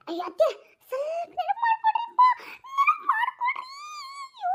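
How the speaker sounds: high, whining plea; high pleading whine to her mother-in-law; pleading cry. Acted. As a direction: beg her to remember, near tears.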